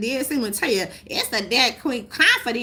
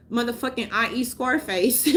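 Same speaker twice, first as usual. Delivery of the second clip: normal talking voice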